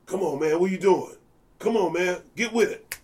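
mock-chide a struggling young bassist; imitating Bobby's scolding. Retelling Bobby's on-stage scolding.

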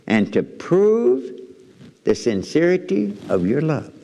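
"prove" stretched slow, pauses between phrases; drawn-out emphasis. Shown as a demonstration. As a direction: lean hard on "prove". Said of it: "Now notice this next statement".

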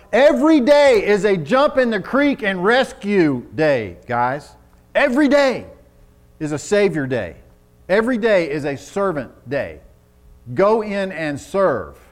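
loud, high preacher's call; rally the men to serve; fervent preaching. Acted. Preaching at full force.

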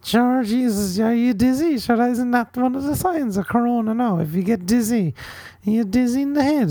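high, lilting auntie voice; fussing Irish aunt. Pretend. Irish aunt asking if you're dizzy, high fussing voice. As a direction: fuss over them in a high comic voice.

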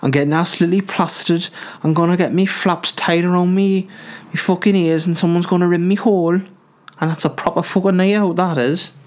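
put-on high-pitched Geordie party girl caricature; mock Geordie accent. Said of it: "then they're like".

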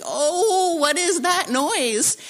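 high, alarmed squeak of surprise; startled cry. Retold or imitated. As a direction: gasp it out like a strange sound just hit you. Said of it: "I'm like".

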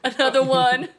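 hammed-up hype-man yell, high and very loud. Topping co-hosts' rapper quotes, loud mock yell. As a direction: belt it out as a mock producer tag.

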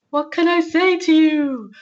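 high, loud, slow helpless plea; mock bewildered exasperation. Describing her partner walking on eggshells, high and helpless. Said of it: "So it's like".

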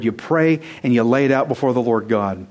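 ordinary talk, nothing marked

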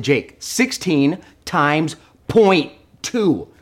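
drawn out, each word spaced; slow patient repeat. Retold or imitated. Dad slowing down for his son, patiently drawn out. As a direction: spell it out slowly for the kid.

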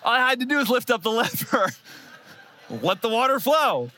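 laughing through the words. Real, not acted. Laughing at himself about the water pump. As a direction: laugh while admitting the mistake. Laughing at his own mistake.

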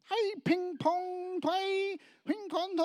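very high, loud, drawn-out babble; mock Chinese-sounding tongue. Preacher imitates big bearded guy praying in tongues, mock Chinese-sounding. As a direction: stretch the nonsense syllables in a comic high voice. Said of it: "the funniest Chinese-sounding tongue".

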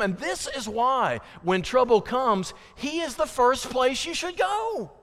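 high, forceful sermon delivery; impassioned preaching. Real, not acted. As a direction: drive the point home from the pulpit.

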